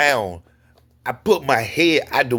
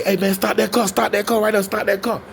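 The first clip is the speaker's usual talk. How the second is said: raised pitch, insistent plea; retold urgent call to halt someone; 7 semitones higher than usual. Acts urgent call to stop car.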